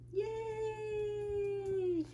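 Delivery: end-of-story hooray; cheer. Real, not acted. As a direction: draw out a high celebratory squeal.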